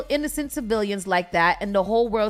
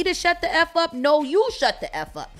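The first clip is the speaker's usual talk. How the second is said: retold angry comeback; 7 semitones higher than usual. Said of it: "then tell me".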